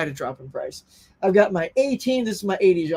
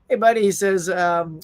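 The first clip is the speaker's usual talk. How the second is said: normal talking voice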